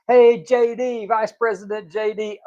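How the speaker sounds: high-pitched hail to someone important; mock calling out. Transgender speaker pretending to hail the Vice President, mock calling out. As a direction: call out high, as if heckling.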